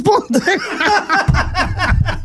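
words stretched and breaking into laughs; laughing retelling. Retold or imitated. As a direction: crack up while quoting your friend. Cracks up retelling the friend's words.

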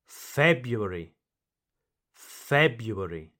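February said FEB-yoo-air-ee; first R dropped. Shown as a demonstration. Teacher models pronunciation number two, February without its first R. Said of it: "Here you do not pronounce the first".

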